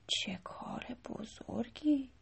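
soft unvoiced murmur, slowly; under his breath. A narrator's reading. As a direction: whisper it in quiet awe. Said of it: "زیر لب گفت".

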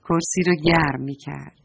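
ordinary delivery, nothing marked

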